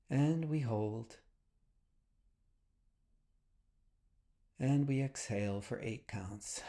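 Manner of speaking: soft, unhurried meditation voice with long pauses; guided meditation. Real, not acted. Host leading listeners through breathing and release, soft guided meditation. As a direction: quietly guide them into breath and body, leaving space between phrases.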